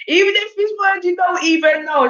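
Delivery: high-pitched, laughing through it. Real, not acted. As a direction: crack up while giving the advice. Laughing at own advice.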